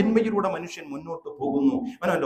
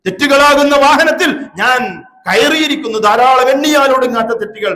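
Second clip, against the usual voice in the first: loud, raised sermon voice; forceful preaching; 6 semitones higher, 9 dB louder than usual. Real, not acted. Preacher criticises the mawlid verses to the gathering, forceful preaching.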